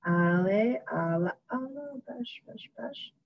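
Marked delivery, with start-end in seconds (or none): 1.5-3.1 very soft repeated pêche; murmured while typing. Real, not acted. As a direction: mutter pêche to yourself as you type.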